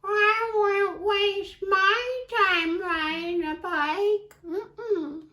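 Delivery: high, slow sing-song voice; snooty cat. Acted. Cat dismissing Duck, high slow sing-song. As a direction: drawl it like an aloof cat. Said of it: "we have to use the voices".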